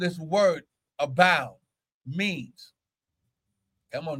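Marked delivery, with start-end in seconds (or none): none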